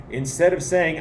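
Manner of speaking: ordinary talk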